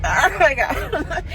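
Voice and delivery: slow, laughing gasp; nervous giggle. Real, not acted. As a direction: laugh it out anxiously. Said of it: "I'm so nervous".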